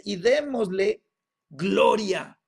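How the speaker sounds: loud, slow, drawn-out declaration; heavenly proclamation. Acted. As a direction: proclaim it slowly, building in volume. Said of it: "y la voz dice". (proclamación lenta y potente)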